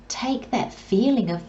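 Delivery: normal talking voice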